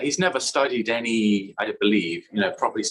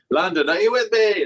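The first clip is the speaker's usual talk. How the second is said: mock stage rally; 9 semitones higher, 5 dB louder than usual. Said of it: "You could never be like".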